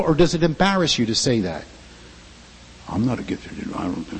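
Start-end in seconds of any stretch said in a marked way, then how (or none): 2.9-4.1 low, soft mumbling voice of a doubter; mock the shy believer who thinks he's no one; lightly voiced downcast believer; timid self-doubter.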